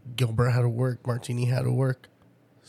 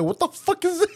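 baffled squeak; 5 dB louder than usual. Retold or imitated. Confused reply to grandpa.

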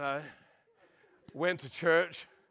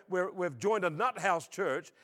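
ordinary talk

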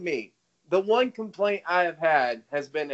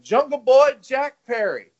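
put-on name called out higher and louder, "Jungle Boy Jack Perry" with the punch on "Boy"; mock announcer call; 7 semitones higher, 4 dB louder than usual. Retelling the commentator naming the wrestler, in a mock announcer call. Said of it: "he was like".

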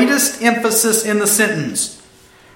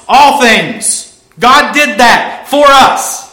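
raised, forceful, punched phrases; emphatic exclamation; 3 semitones higher, 5 dB louder, slower than usual. Real, not acted. Preacher telling the church what God did, loud and emphatic. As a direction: proclaim it loudly, word by word.

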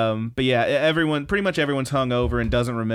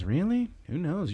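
higher, soft put-on musing; coy character voice; 7 semitones higher, 5 dB quieter than usual. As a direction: tease a friend softly as she drifts off. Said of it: "She goes".

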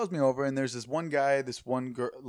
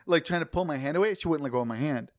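ordinary delivery

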